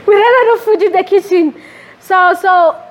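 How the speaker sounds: high, laughing through the line. Real, not acted. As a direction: chuckle while repeating the story. Laughs retelling kitchen story.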